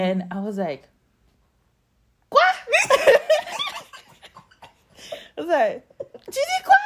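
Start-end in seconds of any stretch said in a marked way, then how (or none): 2.3-6.7 very high laughing shout; shocked quoi. Retold or imitated. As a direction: shriek the French question through laughter. Retold shocked reaction in French.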